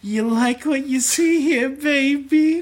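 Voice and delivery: high, slow seductive purr; siren voice. Acted. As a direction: tease him in a high sultry voice. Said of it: "do your siren voice".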